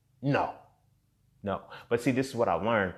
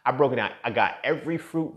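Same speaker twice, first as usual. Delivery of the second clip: ordinary talk, nothing marked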